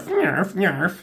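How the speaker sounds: high squeaky cat creature bark "Nyarf, nyarf"; Snarf imitation. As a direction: chirp it like a little cat pet.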